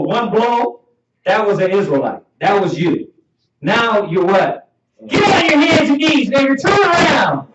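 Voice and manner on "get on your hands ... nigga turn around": high, loud officer's command; barked police order. Acted. Officer ordering a man to the ground, shouted command. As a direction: bark it out like a cop making an arrest.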